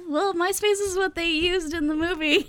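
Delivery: high, loud, laughing defence; amused squeal of self-defence; laughing protest. Real, not acted. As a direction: protest through real laughter. Defends the story while laughing.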